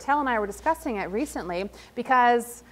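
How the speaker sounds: normal talking voice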